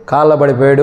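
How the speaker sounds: normal talking voice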